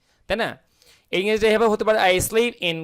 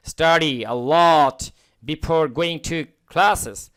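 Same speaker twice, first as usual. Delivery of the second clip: slow model reading; much slower than usual. Shown as a demonstration. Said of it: "teacher reads model sentence slowly".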